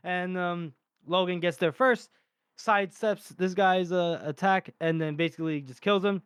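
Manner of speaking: ordinary talk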